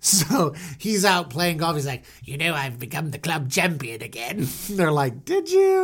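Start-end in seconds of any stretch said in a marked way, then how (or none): 2.2-4.5 put-on smug Pharaoh voice; boastful Pharaoh. Pharaoh boasting to golf companions, put-on voice. 5.2-5.8 very high, put-on voice; fawning companions. Golf companions humouring Pharaoh, high put-on voice.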